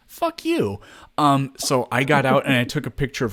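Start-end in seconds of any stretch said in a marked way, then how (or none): 0.1-0.8 mock retort. Retold angry retort.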